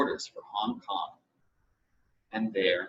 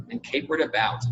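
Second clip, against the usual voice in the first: plain reading voice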